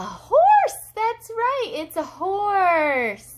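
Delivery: very high, loud burst, easing down; excited reveal. Real, not acted. Mother confirming the animal for her toddler, excited and loud. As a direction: cheer the answer, stretching the last phrase.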